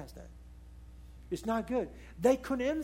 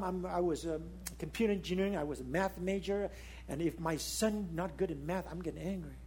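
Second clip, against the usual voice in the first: usual speaking voice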